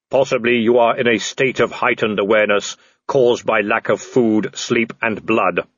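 level, booming supernatural figure; Death. Acted. Death addressing the man in the tree, solemn intoning. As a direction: intone it evenly, without hurry.

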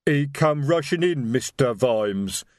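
slow, rumbling troll voice. A narrator's reading. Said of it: "rumbled Detritus".

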